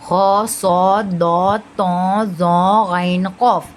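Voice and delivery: "खौ, सौद, दौद" chained slowly with rounded vowels; slow heavy letters, "खौ" through "कौफ", each rounded; heavy, full-mouthed. Shown as a demonstration. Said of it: "मुंह भर के पढ़ेंगे".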